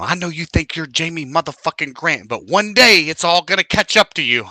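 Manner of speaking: mom warning. Retold or imitated. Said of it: "she told me, she said".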